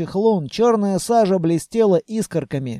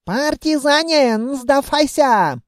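put-on high, slow accented call to surrender; drawn-out mock yell of enemy soldiers; yelling elves; 8 semitones higher, slower than usual. A narrator's reading. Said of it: "принялись орать эльфы". (протяжно орущие эльфы)

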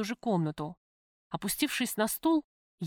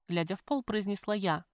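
ordinary delivery, nothing marked

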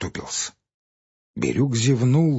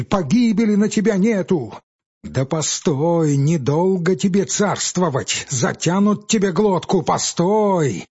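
much higher and louder, raging; furious cursing; 7 semitones higher, 4 dB louder than usual. Acted. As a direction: scream the curses at him. Peasant curses forester.

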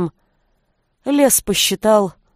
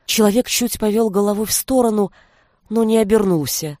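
usual speaking voice

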